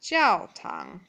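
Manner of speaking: ordinary delivery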